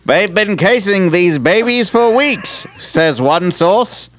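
high, slow dramatic radio announcer; old-timey radio voice. Retold or imitated. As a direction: ham up a vintage radio narrator. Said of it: "old-timey radio voice".

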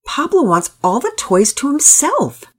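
high-pitched exasperated complaint; frustrated. A narrator's reading. Pearson tells Daddy about Pablo hogging toys, frustrated. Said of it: "Pearson sounded frustrated".

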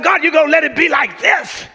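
mock-indignant fuss at the rain; fussing with God. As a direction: grumble at God like a spoiled child. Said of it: "fussing with God".

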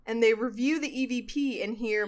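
ordinary talk, nothing marked

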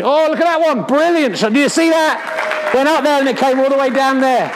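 excited. Pretend. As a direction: gush over the shot. Commentator thrilled by a shot.

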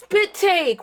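high fake spit-take shout; mock shocked spit take. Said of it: "I thought you were actually gonna do it".

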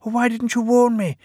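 high-pitched, aggrieved character voice; reproachful. A narrator's reading. Peter Sam asks Sir Handel for an explanation, reproachful. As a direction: protest in a high little voice.

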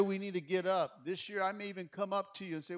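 ordinary talk, nothing marked

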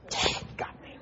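breathy whisper to himself; whispered aside. Real, not acted. The pastor caught out by the stranger, a whispered aside. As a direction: whisper it like a rueful confession.

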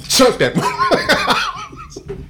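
laughing. Real, not acted. Retelling throwing the flip-flop.

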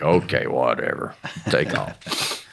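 low, offhand brush-off; dismissive. Retold or imitated. Husband letting wife go look again, dismissive.

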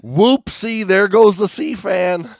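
high, loud singsong whoopsie; goofy clueless sitcom voice. Retold or imitated. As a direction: sing out each disaster like a cheerful klutz. Mock clueless diver, escalating.

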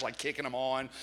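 ordinary delivery

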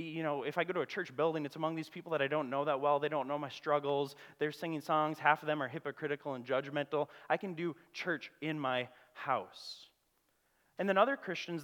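ordinary talk

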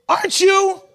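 high-pitched challenge to a suspect; accusing bystander. Acted. Second person confronting Peter, mock accusation. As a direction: pitch it up, pointing him out. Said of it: "A second person comes up to Peter".

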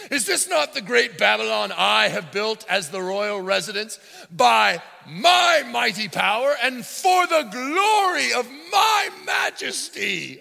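high, loud, very slow grandiose boast; over-the-top emperor admiring his city; pompous cartoon king. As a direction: bellow it like a vain cartoon ruler. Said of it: "is this a cartoon?".

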